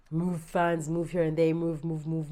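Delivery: usual speaking voice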